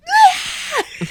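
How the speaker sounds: very high shrieking scream; mock scream. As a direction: shriek like a startled sister. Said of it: "she just goes".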